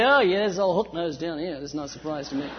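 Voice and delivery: a lightly voiced scornful disciple; mock sneering disciple. Disciple mocking Judas behind his back, with a sneering chuckle. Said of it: "the disciples didn't say".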